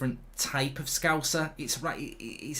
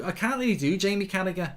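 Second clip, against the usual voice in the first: normal talking voice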